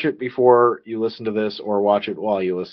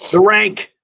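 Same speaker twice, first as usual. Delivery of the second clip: high, loud segment announcement; punchy announcer-style shout; big announcement; 10 semitones higher, 8 dB louder than usual. Pretend. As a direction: belt it out like a title reveal. Announcing the show's main segment.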